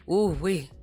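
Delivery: ordinary talk, nothing marked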